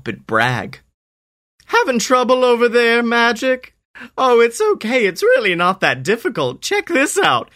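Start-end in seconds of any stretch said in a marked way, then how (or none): 1.7-7.5 high, sneering show-off voice; smug bully. Acted. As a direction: taunt him with a smirk. Snakeman brags and taunts.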